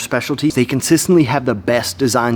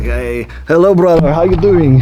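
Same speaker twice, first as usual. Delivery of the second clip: mock Dagestani accent, getting louder on the greeting; friendly Dagestani brother greeting, imitated; 4 dB louder than usual. Cormier greeting people, in a mock Dagestani accent. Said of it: "He puts on the Dagestani accent".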